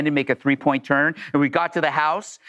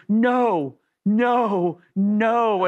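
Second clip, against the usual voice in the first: drawn-out, higher mock dismay; dismayed realization; 5 semitones higher, much slower than usual. Woman notices how much she is despised, dismayed. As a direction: imitate someone horrified at their mistake.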